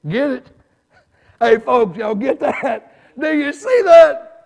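loud, laughing preacher push; preaching at full force. Acted. Preacher demanding the congregation see his point, loudly. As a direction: drive the point home to the pews.

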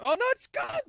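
sudden high cry of dismay. Real, not acted. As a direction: yelp as it vanishes. Rainbow vanishes before photo.

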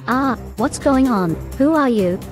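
high, startled cry of fear; scared toy caught off guard; frightened. Acted. Frightened character ambushed.

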